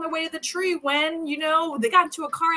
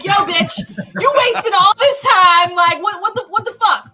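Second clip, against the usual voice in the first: much higher, louder, laughing mock outrage; angry townspeople; 8 semitones higher, 10 dB louder than usual. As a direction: yell at her like a furious crowd, half laughing. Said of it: "They're like".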